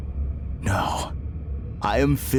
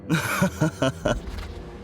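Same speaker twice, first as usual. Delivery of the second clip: amused chuckle; 6 semitones higher than usual. Acted. Polite villain answers his accuser, amused chuckle.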